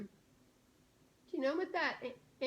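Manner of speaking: usual speaking voice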